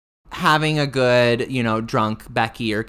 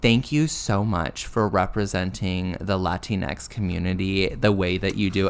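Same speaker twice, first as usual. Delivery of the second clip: earnest admirer; 6 semitones lower, 4 dB quieter than usual. Retold or imitated. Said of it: "she said".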